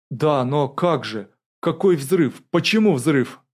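alarmed. A narrator's reading. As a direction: let alarm raise your voice. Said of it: "их тревога передалась и ему". (встревоженно, растерянно)

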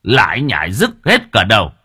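loud, harsh scolding; angry snap. Acted. Chief turns and yells at whispering villagers. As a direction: bark at the gossiping crowd to shut up. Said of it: "quay lại quát".

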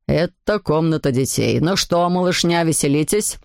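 low grown-up man's voice; father to kids. A narrator's reading. Father looks in on the playing children, in a low voice. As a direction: play the father, pitched well down.

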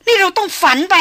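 high, loud cry of disbelief; distraught denial. A narrator's reading. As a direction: cry out, refusing to accept it. Woman refuses to believe the death.

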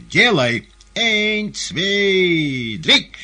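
very loud, high, stretched count; shouted command. A narrator's reading. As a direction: bark out the countdown. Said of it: "закричал он коту".

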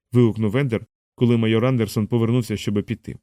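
ordinary delivery, nothing marked

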